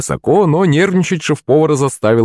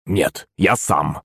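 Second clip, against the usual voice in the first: low, slow, firm refusal; curt refusal; 5 semitones lower, 5 dB quieter, much slower than usual. A narrator's reading. Servant to chef offering a helper, curt and low. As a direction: refuse firmly, keeping it low. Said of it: "резко ответил Захарыч".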